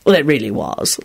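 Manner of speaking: mildly offended agreement. A narrator's reading. Lupin protesting his friend's rudeness, mildly offended. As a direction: insist with a touch of hurt. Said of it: "said in an offended tone".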